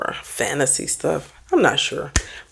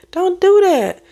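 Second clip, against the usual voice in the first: scolding; 14 semitones higher, 5 dB louder, slower than usual. Real, not acted. Scolding commenters.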